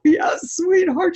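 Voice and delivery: put-on high sugary reply to a child; mock-tender mommy tone; lightly sweet answer to her daughter. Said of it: "I go".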